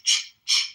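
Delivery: a sharp voiceless ch, ch; unvoiced ch sound. Shown as a demonstration. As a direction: spit out the ch sound twice. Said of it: "ends with the unvoiced C H".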